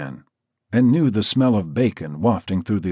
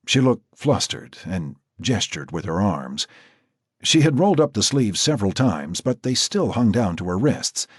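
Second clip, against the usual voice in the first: usual speaking voice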